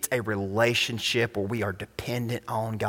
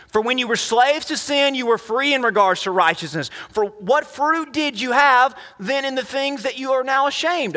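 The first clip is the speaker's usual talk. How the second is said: raised-pitch scripture reading; preaching scripture; 7 semitones higher than usual. Acted. Preacher reading about slaves of God, raised declamatory voice. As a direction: read it out like a proclamation, settling at the end. Preacher reads verses aloud.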